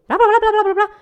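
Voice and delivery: high, loud mock babble of a foreign language; gibberish blah blah. Mimicking a language she couldn't follow, high and loud. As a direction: rattle off nonsense like unintelligible chatter. Mocking incomprehensible speech.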